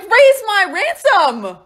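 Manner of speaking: high, loud, slow order; haughty demand. Retold or imitated. Caesar ordering the pirates, slow and haughty. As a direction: command it slowly and loudly.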